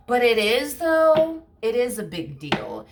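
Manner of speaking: rising, emphatic protest; emphatic rebuttal. Real, not acted. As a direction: insist it matters. Rebutting the dismissive person.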